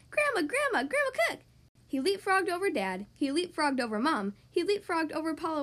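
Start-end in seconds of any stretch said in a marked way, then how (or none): 0.1-1.4 eager, high-pitched calling out; excited cry. A narrator's reading. Young frog greeting his grandma, high excited cry. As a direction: call out high with eager delight. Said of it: "he cried".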